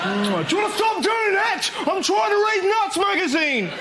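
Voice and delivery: high-pitched hammed-up yell of complaint; outraged mock shout. Passenger objecting to the kissing couple on a plane, outraged mock shout. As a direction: shout it very high, as a put-on protest.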